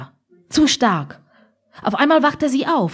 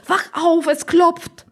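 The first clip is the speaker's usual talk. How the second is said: high-pitched, insistent order; urgent waking; 7 semitones higher, slower than usual. Acted. Oma pokes sleeping Opa in the shoulder, urgent. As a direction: rouse him with a high, urgent voice.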